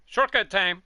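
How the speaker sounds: loud, high game-time announcement; excited call-out. Real, not acted. Takes a shortcut in race.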